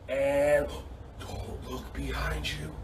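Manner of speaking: very soft, low, slow warning; hushed suspense. Pretend. Coach warning kids of pretend shark, hushed warning. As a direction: barely voice it, drawing out the dread.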